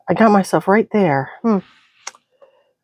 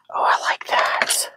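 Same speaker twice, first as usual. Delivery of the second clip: whispering; 5 dB quieter than usual. Real, not acted. Crafting aside, whispered.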